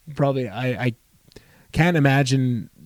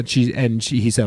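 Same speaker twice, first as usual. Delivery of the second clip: ordinary delivery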